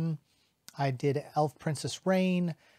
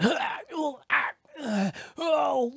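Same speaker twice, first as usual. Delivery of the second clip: high cartoon cries and grunts; string of startled, high-pitched exclamations; acted falling yelps; 11 semitones higher, slower than usual. Shown as a demonstration. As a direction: incidental noises of someone falling off a cliff. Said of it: "you're falling off a cliff".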